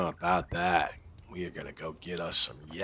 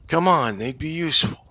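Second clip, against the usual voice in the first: higher, louder pleading; 9 semitones higher, 10 dB louder than usual. Real, not acted. Gamer pleading with Emily for yaks, higher and louder. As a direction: beg her to see sense.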